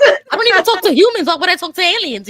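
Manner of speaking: loud, high disbelief; incredulous shout. Real, not acted. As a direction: yell it in disbelief. Shocked refusal to talk aliens.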